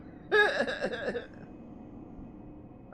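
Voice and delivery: high-pitched chuckle trailing off; high giggle. Real, not acted. Streamer amused by his own greeting, high giggle. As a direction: giggle high, letting it fade. Laughing after silly greeting.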